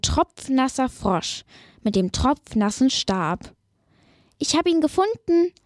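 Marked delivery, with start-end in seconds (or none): 4.4-5.5 higher, fast frog voice; lightly voiced frog; eager frog. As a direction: blurt it out proudly as a frog. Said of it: "sagt er".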